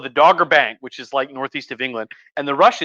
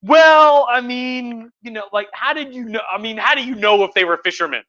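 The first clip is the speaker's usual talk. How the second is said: defensive Russians; 7 semitones higher than usual. Retold or imitated. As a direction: play dumb, voice jumping up high. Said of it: "Russians are like".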